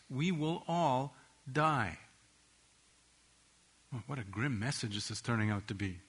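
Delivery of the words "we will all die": slow and grave. Shown as a demonstration. Preacher repeats the truth gravely.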